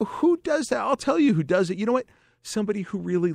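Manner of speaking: very high, incredulous rant; high-pitched comic outrage. Real, not acted. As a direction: squeak it out in disbelief. Incredulous rant about the puncher.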